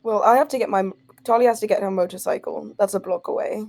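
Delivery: ordinary talk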